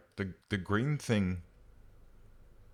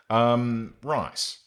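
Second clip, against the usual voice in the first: normal talking voice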